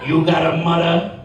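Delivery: put-on ominous Caribbean-accented question; loaded question, island accent; Caribbean man. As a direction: ask pointedly in a Caribbean accent. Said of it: "He's like".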